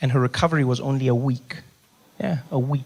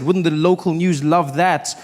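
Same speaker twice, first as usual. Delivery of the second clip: ordinary talk, nothing marked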